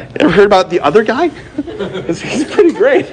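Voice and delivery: quick, high, giggling tease; mock sales pitch, laughing. Pastor joking to the Satanists about God, quick laughing tease. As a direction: sell the other guy with a laugh.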